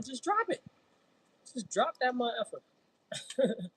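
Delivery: high, drawn out and slow; high slow playful emphasis; high emphatic. Real, not acted. Emphatic advice, slowed drawn out.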